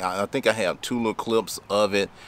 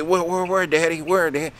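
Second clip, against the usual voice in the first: put-on higher, quick questions like a keen boy; eager son; 8 semitones higher than usual. Son asking his dad where the snake is, eager.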